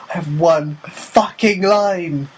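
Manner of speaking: slow, high, drawn-out exasperation; mock indignation. Actor whose only line was stolen, mock indignation. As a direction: stretch out the outrage, word by word.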